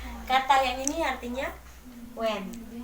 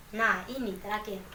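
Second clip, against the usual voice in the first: usual speaking voice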